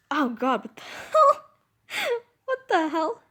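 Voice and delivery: high, loud cry of shock with a laugh; real startled squeal, half laughing; startled outburst. Real, not acted. Reader startled by an unexplained mishap mid-story, shocked outburst.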